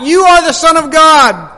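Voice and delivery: loud, high proclamation of demons; shout. Acted. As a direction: cry it out as if forced to confess. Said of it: "fall down before him and shout".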